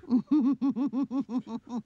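high happy giggle; girlish giggle. Acted. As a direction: giggle brightly.

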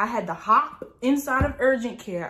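ordinary talk

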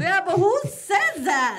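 slow, high, loud disbelief; who on earth says that; loud, high disbelief at her co-host; incredulous. Real, not acted. Disbelief at friend's claim.

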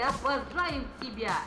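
ordinary talk, nothing marked

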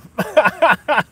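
a loud, shrill burst of laughter; loud high laugh. Real, not acted. Laughs at singing request.

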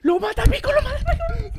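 high, loud disbelieving shout through laughter; laughing shout. Real, not acted. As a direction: scream it out cracking up. Shouting at a wild kill.

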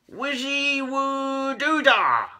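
high, slow, loud incantation; crying out a spell. A narrator's reading. Keith casting for impatient cats, drawn-out cry. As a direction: draw out the nonsense spell loudly. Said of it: "cried Keith".

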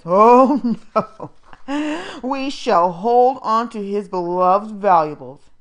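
loud, slow, gloating voice with a laugh; mocking foster mother. A narrator's reading. Foster mother taunting the boy, slow gloating. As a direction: savour keeping his treasures.

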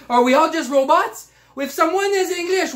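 ordinary talk, nothing marked

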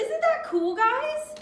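put-on high-pitched, excited children's host; perky presenter. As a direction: pitch it way up for the kids watching. Retake for the children's show.

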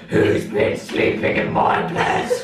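slow, throaty storybook bear growl; Daddy Bear voice. Shown as a demonstration. Daddy Bear finding the bed slept in, in a slow growl. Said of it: "gonna do that voice anyway".

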